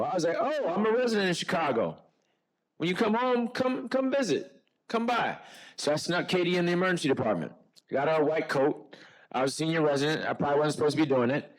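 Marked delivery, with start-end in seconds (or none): 0.4-5.4 eager invitation. Retold or imitated. Inviting student to visit Chicago.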